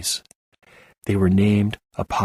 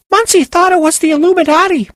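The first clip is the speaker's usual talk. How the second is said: very high, loud squeaky retort; helium snap; 8 dB louder than usual. Acted. Penny snapping back at the group, squeaky high voice. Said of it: "Penny snapped back".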